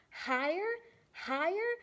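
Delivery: soaring excited build; rising excitement. Acted. Rocketing upward.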